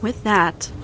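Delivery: plain reading voice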